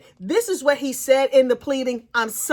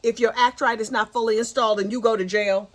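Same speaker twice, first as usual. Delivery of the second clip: normal talking voice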